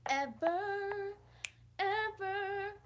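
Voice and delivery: EVER held high, almost sung; drawn-out EVER. Real, not acted. Vlogger milking the lesson, EVER drawn out high and singsong. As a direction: stretch each EVER out on a high note. Dragging out the emphasized word.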